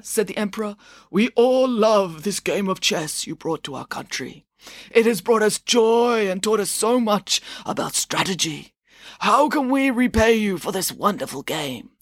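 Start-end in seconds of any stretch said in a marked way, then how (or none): 1.1-11.9 a mild hint of an old man. Mum voicing the emperor to her daughter, a light old man voice. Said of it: "I'm doing an old man voice".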